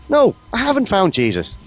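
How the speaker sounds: put-on high-pitched comic drunk reply; joke drunkard. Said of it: "The drunk replies".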